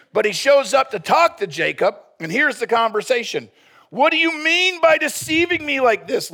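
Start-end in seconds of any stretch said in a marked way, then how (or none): 3.9-6.3 high, loud indignant father-in-law; Laban demanding. Acted. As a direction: demand it like a wronged patriarch, pitched up. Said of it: "Laban demanded".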